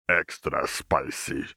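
slow, low, teasing cook voice; a big cook's sly slow drawl; Jericho's gruff voice. A narrator's reading. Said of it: "Jericho grunted".

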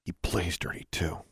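hushed private thought; inner thought. A narrator's reading. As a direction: think it to yourself. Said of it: "Alexandra thought".

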